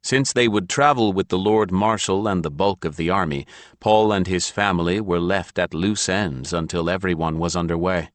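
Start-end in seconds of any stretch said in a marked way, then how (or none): none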